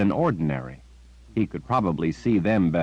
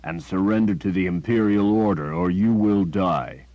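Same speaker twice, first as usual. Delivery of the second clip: growled. A narrator's reading. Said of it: "the man holding the girl growled".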